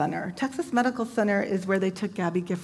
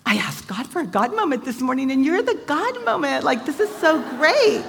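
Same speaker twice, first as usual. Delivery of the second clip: high, loud, thrilled retelling; excited exclamation; 8 semitones higher, 6 dB louder than usual. Retold or imitated. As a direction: burst out with joy at the God moment.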